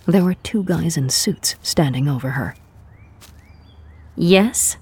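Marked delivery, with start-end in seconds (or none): 4.1-4.8 put-on a clipped, annoyed Japanese-accented yes. A narrator's reading. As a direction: ask it with an irritated Japanese accent. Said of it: "in her best annoyed Japanese accent".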